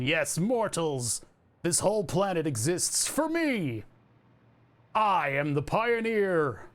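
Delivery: slow, grand pronouncement from above; mock deity. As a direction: lord it over the mortals, slowly. Godlike floating position proclamation.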